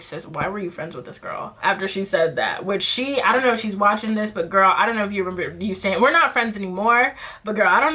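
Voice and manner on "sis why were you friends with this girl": much softer, lower aside; aside to herself. Retold or imitated. Asking her past self, softer and lower. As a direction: mutter it to yourself. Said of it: "I'm like".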